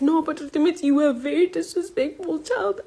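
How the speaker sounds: a shrill parody of mom defending herself; high mocking mom voice. Daughter retelling to viewers, mimicking her mother's high voice. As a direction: pitch it way up to mock the mother.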